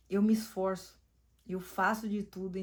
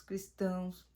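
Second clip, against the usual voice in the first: ordinary talk, nothing marked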